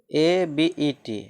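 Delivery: ABET spelled letter by letter, slowly; slow spelling. Shown as a demonstration. Teacher spells word for class, slow. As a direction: drag out each letter for students. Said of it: "ठीक".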